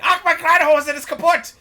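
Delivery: loud, high-pitched mock German outburst; hammed-up German. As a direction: yell it like an exaggerated flawless German. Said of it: "perfect fluent German".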